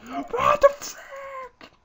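very high slow shriek; shrieking outrage. Real, not acted. Player's chicken dies, high outraged shriek. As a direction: shriek it in disbelief.

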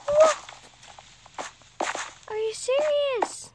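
high squealed disbelief; shocked disbelief. Real, not acted. Shocked at game texture.

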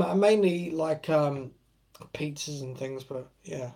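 usual speaking voice

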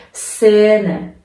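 سنه with the first vowel drawn out slowly; long ä in Zähne. Shown as a demonstration. Teacher demonstrating the long vowel in سنه. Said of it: "کشیده تر تلفظ بشه".

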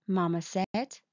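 plain reading voice

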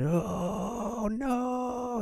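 high, drawn-out wail of alarm; panicked cry. A narrator's reading. Kids about to take off running, high panicked cry. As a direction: cry out before fleeing.

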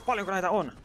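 high incredulous question; exasperated. Real, not acted. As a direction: ask in disbelief. Exasperated at mobs.

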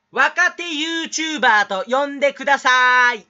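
mock articulate speaker. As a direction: project every syllable like a showy elocutionist. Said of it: "いい人そうな人のモノマネ". (滑舌のいい人のモノマネ、大声でゆっくり)

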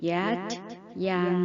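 normal talking voice

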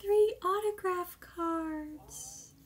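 high and slow, thrilled disbelief; real delight, stretched out; excited. Real, not acted. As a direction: gush over a surprise gift. Overjoyed opening fan gift.

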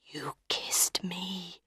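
slow, stunned whisper. A narrator's reading. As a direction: barely breathe it out, very slowly. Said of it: "he whispered".

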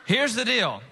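ordinary delivery

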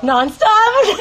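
high, loud shrieking laugh; incredulous shriek. Real, not acted. Repeating her sister's word with a delighted shriek. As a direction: squeal the word in delighted disbelief. Sister says they play it nonstop.

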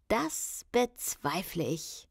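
slow, smug villain tone; sly smirk. Acted. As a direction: say it with a nasty grin. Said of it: "mit einem infamen Lächeln". (langsam, mit hämischem Grinsen)

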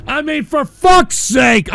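loud, slow, exasperated yell; real irritated shout; fed up. Real, not acted. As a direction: shout them down in frustration. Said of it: "I'm doing a video".

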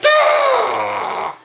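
long, high-pitched scream; frustrated scream. Real, not acted. Frustrated scream during fight.

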